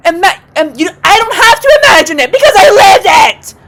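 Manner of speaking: high, loud shouting; exasperated outburst. Real, not acted. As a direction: burst out in indignation. Outburst about living with roaches.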